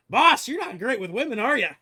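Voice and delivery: loud, high-pitched ribbing; teasing sidekick. Acted. Cassidy looks at his boss after an awkward moment, teasing.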